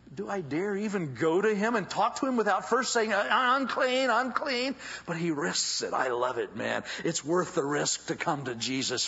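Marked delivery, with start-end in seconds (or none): 3.1-4.7 leper's call. Acted. Leper's cry.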